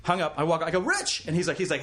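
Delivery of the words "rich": high call across a lot. Retold or imitated. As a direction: shout his name to catch him. Calling out to the director.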